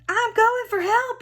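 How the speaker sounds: high, loud panicked yelp; frightened call. A narrator's reading. Principal fleeing the dragon, high panicked call. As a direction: call out in fright while running. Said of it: "he called over his shoulder".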